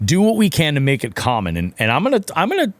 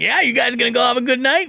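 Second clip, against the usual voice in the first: high, bright mock-casual question to a stranger; breezy high-pitched friendly ask; friendly question at the ramp; 12 semitones higher, faster than usual. To a guy at the boat ramp, mock-casual high voice. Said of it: "I was like".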